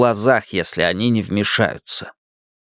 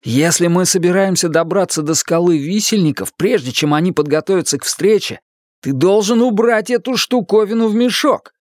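raised, urgent pleading voice; urgent plea; 6 semitones higher than usual. Acted. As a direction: press him to put it away. Cuthbert urges Roland to put it away.

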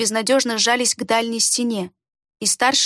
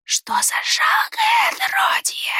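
high hoarse sneer, slow; slower than usual. A narrator's reading. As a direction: spit it out with contempt. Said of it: "сиплый голос".

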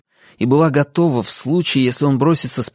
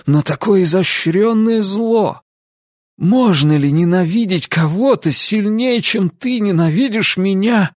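higher, impassioned accusation; bitter reproach; 8 semitones higher than usual. A narrator's reading. Ansel asking Lucy about her hatred, bitter. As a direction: build into a pained question.

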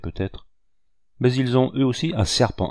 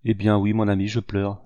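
ordinary delivery

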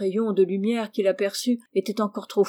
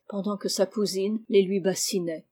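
plain reading voice

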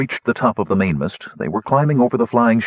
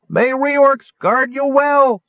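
high, slow, drawn-out farewell call; faint gnome cry; 4 dB louder, much slower than usual. A narrator's reading. Wingover bidding Sturm farewell from afar, slow high cry. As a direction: let it float away, high and slow. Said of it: "Then faintly ... Wingover cried".

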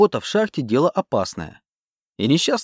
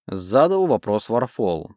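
plain reading voice

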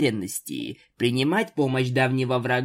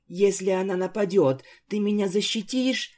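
scared little sister; 7 semitones higher than usual. A narrator's reading. Little Isabel pleads with sister.

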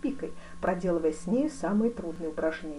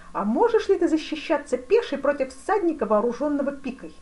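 loud, high eager questioning; великий князь, азартный; 8 semitones higher, 7 dB louder than usual. A narrator's reading. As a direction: fire the question with raised voice. (азартно и громко)

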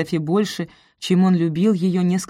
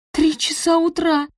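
high-pitched shocked outburst; aghast exclamation; 11 semitones higher, 3 dB louder than usual. A narrator's reading. Sophie hears the clock strike three, aghast. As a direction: gasp it out in dismay. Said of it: "Да так и ахнула".

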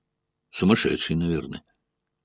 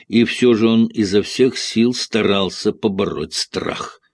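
plain reading voice

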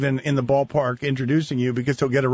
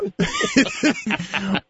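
high laugh; 11 semitones higher than usual. Real, not acted. Host laughs at the joke.

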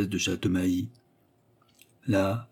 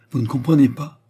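louder, higher indignant question; sudden vehemence; 5 semitones higher, 7 dB louder than usual. A narrator's reading. Widow rounding on the duke, suddenly vehement. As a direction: burst out at him, vehemently. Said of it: "avec une véhémence subite".

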